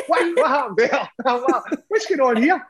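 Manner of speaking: high-pitched, amused disbelief; mock bewilderment. Drunk player seeing the back page, high comic bewilderment. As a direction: replay your shock in a high, laughing voice. Retold shock at newspaper picture.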